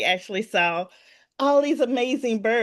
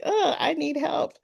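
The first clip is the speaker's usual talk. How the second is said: small plea for help; 8 semitones higher than usual. Retold or imitated. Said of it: "And I was like".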